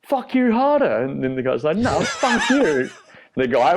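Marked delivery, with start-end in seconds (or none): 0.0-1.0 high-pitched, hammed-up retort; mock angry comeback. Storyteller acting his friend's reply to the driver, hammed-up yell. Acted road-rage shouting match. 1.8-2.9 high, drawn-out imitation of a yell; mock angry retort. Storyteller acting the driver's answer, high mock yell. Acted road-rage shouting match.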